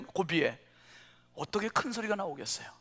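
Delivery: ordinary talk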